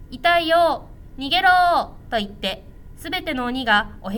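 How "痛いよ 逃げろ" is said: higher, louder mock cry of pain; hammed-up ogres fleeing. A narrator's reading. Ogres flee the classroom, hammed-up cries. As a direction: yelp and run like beaten ogres.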